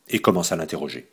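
usual speaking voice

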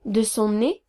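usual speaking voice

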